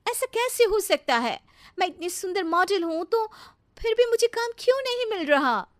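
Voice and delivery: very high-pitched, frustrated wail; upset, distressed. Acted. Model upset that no work is coming, distressed. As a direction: fret aloud in disbelief. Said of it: "नैना बहुत परेशान रहने लगती है".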